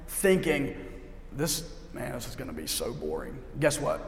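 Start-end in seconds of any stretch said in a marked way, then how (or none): 1.3-3.4 low, soft grumble; bored dread. Acted. Churchgoer expecting a boring morning, bored low grumble. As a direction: think it aloud, low and weary of it already.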